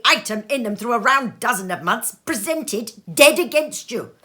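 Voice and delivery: lightly voiced miser sneering at Christmas; Scrooge scoffing. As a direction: spit out the list of grievances. Scrooge ranting at nephew.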